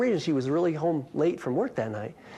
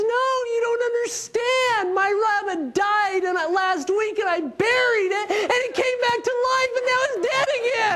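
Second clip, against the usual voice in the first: much higher, loud, hysterical explanation; imitating frantic neighbor; 3 dB louder than usual. Neighbor explains her rabbit came back to life, shrill hysterical rush. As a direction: rattle it off like a panicked neighbor near tears.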